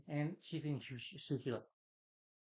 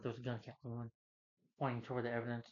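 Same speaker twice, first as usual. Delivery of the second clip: ordinary talk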